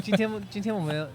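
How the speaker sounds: energetic host voice. Retold or imitated. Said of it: "it takes up so much energy".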